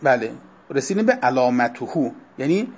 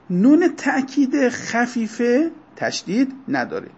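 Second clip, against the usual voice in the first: ordinary delivery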